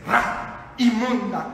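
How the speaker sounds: rasped, unvoiced start to an insult; imitating a scornful singer. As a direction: spit it out with scorn. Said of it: "Le dice".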